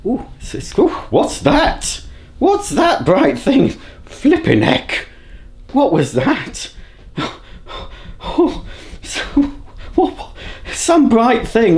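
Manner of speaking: high, drawn-out squeals of feigned wonder; baffled by a strange sight, hammed-up and high; mock amazement. Vlogger spotting the sun through her window, mock amazement.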